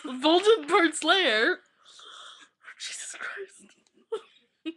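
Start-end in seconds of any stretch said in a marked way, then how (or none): 2.8-4.7 slow whisper of disbelief; whispered exasperation. Real, not acted. Reader whispering dismay at the fanfic. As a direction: breathe it out in despair.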